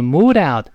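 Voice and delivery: "moved out" joined as "moo-dout"; lightly linked. Shown as a demonstration. Teacher showing linking of two words, "moved out" joined. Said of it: "轻轻的把它连到一块儿".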